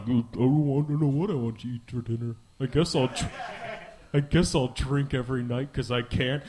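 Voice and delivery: low, dragging gloomy mumble; mopey and dreary. Retold or imitated. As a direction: sound like nothing is worth it. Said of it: "Hell is like".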